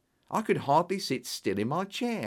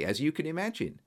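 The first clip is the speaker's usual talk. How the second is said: ordinary delivery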